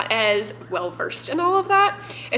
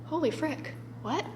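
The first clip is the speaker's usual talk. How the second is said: stunned hush; 6 semitones higher, 7 dB quieter than usual. Retold or imitated. Stunned reaction, retold.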